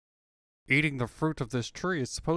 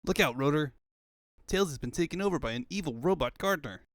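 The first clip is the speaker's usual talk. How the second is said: normal talking voice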